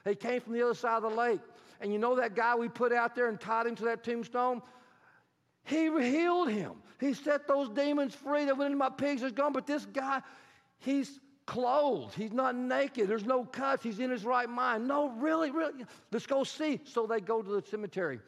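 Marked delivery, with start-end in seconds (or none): 0.0-9.5 excited pig herder. Acted. Herder tells townspeople the news. 14.9-16.8 disbelieving townspeople. Acted.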